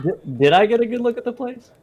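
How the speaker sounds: high and laughing. Real, not acted.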